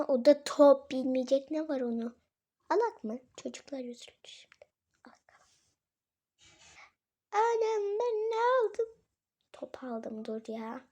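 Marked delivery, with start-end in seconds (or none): 3.4-5.5 quick, soft whisper; fast whispered aside. Real, not acted. Mother whispering an aside while shopping with her daughter. 7.3-8.9 high, slow hammed-up disbelief; mock surprise. Mother hamming up shock at what she bought.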